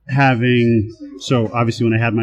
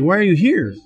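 higher-pitched, puzzled asking; mildly surprised boss; retold puzzled question; 7 semitones higher than usual. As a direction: act out catching someone where they shouldn't be. Boss finds employee working late.

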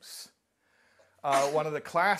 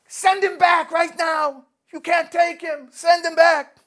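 much higher, louder, slow furious shout; screaming at the sky; 14 dB louder, slower than usual. Acted. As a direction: scream it up at heaven, demanding. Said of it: "she screams up at the skies".

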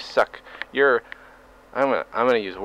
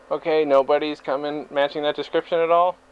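ordinary talk, nothing marked

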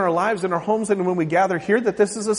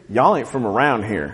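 Southern drawl; 10 semitones lower than usual. Retold or imitated. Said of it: "because I talk different".

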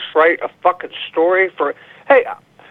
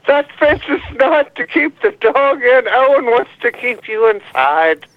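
high-pitched, hammed-up pleading; mock dire warning; 6 semitones higher than usual. Caller warning Amy about the fence, mock dire warning. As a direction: warn in a raised, melodramatic voice.

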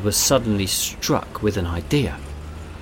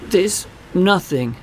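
put-on child voice; 8 semitones higher, slower than usual. A narrator's reading. Boy voiced in story.

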